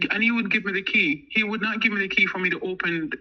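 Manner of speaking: ordinary talk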